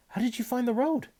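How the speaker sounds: high, eager question. Acted. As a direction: ask urgently. Boy asks after escape.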